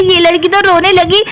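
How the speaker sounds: ordinary delivery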